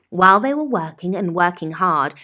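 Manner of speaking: ordinary delivery, nothing marked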